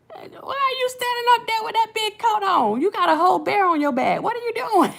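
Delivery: high incredulous squeal of disbelief; shrill-pitched teasing, baffled at the coats; mock disbelief. Viewer mocking a rapper's big fur coat, high and incredulous. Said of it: "I was like".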